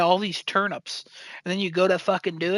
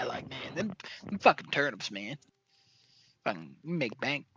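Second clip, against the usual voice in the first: soft muttering; 6 semitones lower, 12 dB quieter than usual. Real, not acted. Musing about turnip profits.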